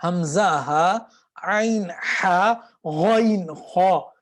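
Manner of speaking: حمزہ ہا, عین ہا, غائن خا each drawn slowly; slow letter drill. Shown as a demonstration. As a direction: chant the three letter pairs slowly. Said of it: "اس طرح کر کے".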